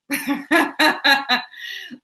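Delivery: loud, high burst of real laughter; laughing. Real, not acted. Meditation teacher amused by a question, real loud laugh. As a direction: laugh out loud in a rapid string.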